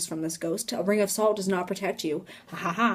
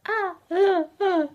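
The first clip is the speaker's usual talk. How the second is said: high-pitched gasped ahs; mock gasping; 10 semitones higher, slower than usual. Teller reacting as friends dare each other, mock gasps. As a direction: gasp it out in short high bursts.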